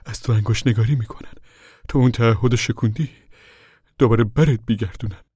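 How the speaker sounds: ordinary delivery, nothing marked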